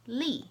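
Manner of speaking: "li" short, falling sharply from high; fourth tone. Shown as a demonstration. Teacher showing the tones, "li" falling. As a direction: drop "li" fast from the top. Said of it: "I'll say the four tones".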